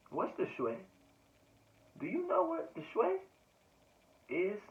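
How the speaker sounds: high-pitched, playful song quote; quoting a song. Retold or imitated. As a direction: lift it way up high, imitating a catchy line. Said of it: "I just heard that song".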